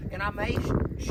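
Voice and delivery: normal talking voice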